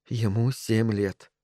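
slow, soft self-reminder; said to herself. A narrator's reading. Mother calming herself outside son's room, to herself. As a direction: tell yourself quietly, slowly. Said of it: "сказала она себе".

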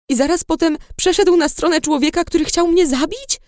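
high, incredulous question; shocked disbelief. A narrator's reading. Patient hears William joined her would-be killer, shocked. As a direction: let the pitch climb in disbelief.

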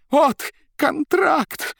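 high, loud, broken outbursts; incoherent shouting. Acted. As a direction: yell in a frantic jumble. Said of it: "бессвязно при этом вскрикивая".

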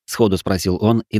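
ordinary delivery

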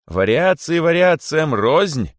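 loud, high, slow royal rebuke; menacing king. A narrator's reading. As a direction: draw it out like a threatening king. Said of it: "грозно подхватил король". (грозно, по-королевски)

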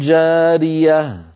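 جارية drawn out slowly after a held nasal; slow, careful جارية; ikhfa demo. Shown as a demonstration. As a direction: press only on جا of جارية. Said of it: "الفم مهيأ على مخرج الجيم من غير الضغط".

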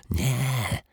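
hushed, gentle denial; whispered reply. A narrator's reading. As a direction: breathe it out as a secret. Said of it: "szepnął detektyw Pozytywka".

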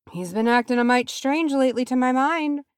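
high muttered aside. A narrator's reading. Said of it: "Sam muttered".

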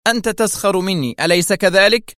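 high, edgy accusation; nervous irritation. A narrator's reading. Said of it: "قالت في عصبية". (اتهام عصبي بنبرة عالية)

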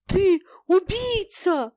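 high, loud, drawn-out accusation; sobbing outcry. A narrator's reading. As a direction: cry it out in anguish. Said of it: "рыдая вскричал Званцев".

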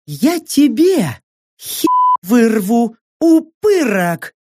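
high, slow, drawn-out threat; fierce woman's menacing vow; threatening female fighter. A narrator's reading. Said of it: "пообещала Сака".

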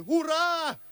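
high, shrill-free imitated crowd shout of «Ура!»; playful high cheer; mock cheer. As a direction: throw out «Ура!» like cheering ladies. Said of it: "кричали женщины".

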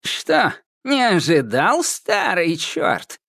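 high, slow gloating witch; old witch relishing his surprise. Acted. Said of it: "с удовольствием злорадствовала Яга". (злорадная старая ведьма)

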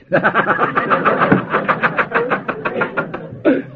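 long high wheezing laugh; hearty laugh. Real, not acted. Preacher joking about pushing Maggie to the table, laughing. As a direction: laugh helplessly until it tails off.

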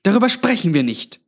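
high-pitched scolding as Granny; gezischte Ermahnung. A narrator's reading. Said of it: "zischte Granny". (leicht gezischte, hohe Zurechtweisung)

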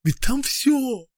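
high, loud, slow cry; shouting. A narrator's reading. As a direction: cry it out desperately. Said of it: "крикнул он".

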